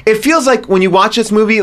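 usual speaking voice

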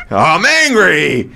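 slow, drawn-out mock anger; hammed-up angry declaration, stretched out; Slumdog Millionaire impression. Host imitates the actor's overdone movie lines, mock angry. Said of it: "it was always like".

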